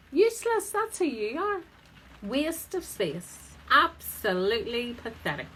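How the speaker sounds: high, loud scolding parrot, sneering at you; nagging parrot voice. Acted. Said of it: "The parrot sits there saying".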